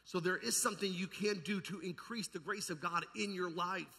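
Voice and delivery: ordinary talk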